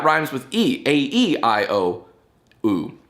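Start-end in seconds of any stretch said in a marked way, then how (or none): none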